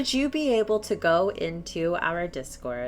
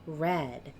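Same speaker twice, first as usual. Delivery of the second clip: slow, hushed, spooky game master telling; eerie scene narration; slower than usual. A narrator's reading. Game master narrating the glowing door to players, hushed and slow.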